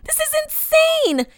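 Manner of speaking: high, loud, frantic protest; desperate outburst. Acted. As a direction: shout a shrieking, urgent plea. Pleading desperately to be let go.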